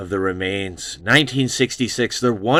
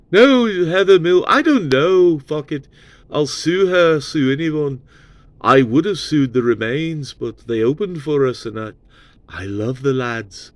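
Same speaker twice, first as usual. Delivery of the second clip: higher, louder cheeky British bluster; 5 semitones higher, 3 dB louder than usual. Retold or imitated. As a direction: toss it off like a careless rock star. [name] voiced shrugging off lawsuit.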